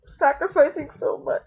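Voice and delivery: overwhelmed, fighting back sobs; crying. Real, not acted. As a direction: voice breaking in tears. Breaking down while filming goodbye.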